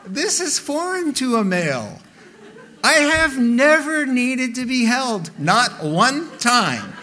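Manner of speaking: loud, high-pitched mock rant; hammed-up baffled male protest; comic indignation. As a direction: build into an exasperated, over-the-top outburst. Acted male outrage at being held.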